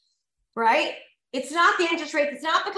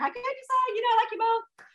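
much higher, mock helpless voice; whiny young self; 10 semitones higher than usual. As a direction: play your indecisive younger self, pitched way up. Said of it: "I'm like".

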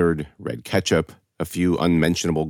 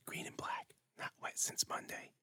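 very soft voiceless whisper; whispering to herself; 24 dB quieter than usual. A narrator's reading. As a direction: whisper privately, as if to yourself. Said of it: "Millie Ann whispered".

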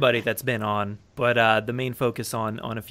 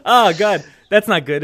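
laughing; 6 semitones higher, 9 dB louder than usual. Real, not acted. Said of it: "Keep that in".

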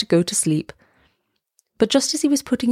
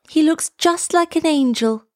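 a hint of children's voices, pitched high; lightly voiced, high-pitched child; charity children; 9 semitones higher than usual. A narrator's reading. Charity children coming out of the cathedral, lightly voiced children. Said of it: "said the charity children".

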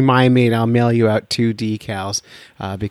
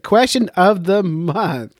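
slow deliberate announcement; 5 semitones higher, much slower than usual. Shown as a demonstration. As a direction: Question of the month drawn out slow. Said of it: "I can't say it".

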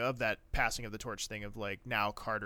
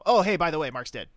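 mock casual; 5 semitones higher, 10 dB louder than usual. Mocking a blunt reveal.

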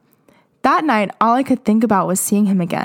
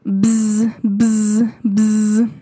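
phone buzz; much slower than usual. Retold or imitated. Imitating the phone buzzing.

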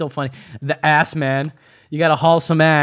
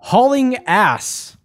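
announcer; 7 semitones higher, 4 dB louder, much slower than usual. Acted. Announcing idiom over music.

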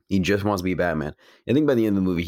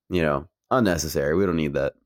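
ordinary talk

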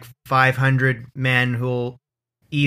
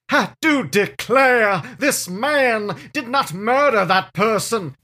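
slow, drawn-out Southern courtroom drawl; Southern lawyer; 5 semitones higher, slower than usual. Retold or imitated. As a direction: plead the case like a grand Southern gentleman. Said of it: "and you'll be like".

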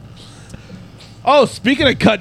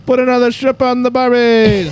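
bad Australian accent; 5 semitones higher than usual. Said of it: "That's my Australian".

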